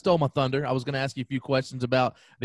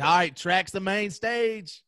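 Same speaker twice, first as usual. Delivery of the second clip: higher, slow mock announcer call; hyped announcer; 7 semitones higher, slower than usual. Host imitating fans hyping track as the main stage. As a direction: lightly play up the hype of the big stage. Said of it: "They're like".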